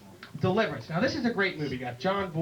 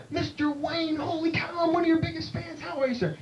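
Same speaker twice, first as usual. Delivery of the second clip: high-pitched gushing fan; starstruck fan; 11 semitones higher than usual. Acted. Bartender meeting John Wayne, starstruck. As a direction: greet your idol in a high excited voice.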